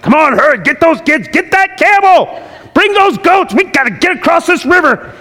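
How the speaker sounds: high, loud, rushed hurry-up call; frantic parent hurrying the family; hurried urging. Retold or imitated. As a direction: herd everyone across in a panic. Families rushing across the river.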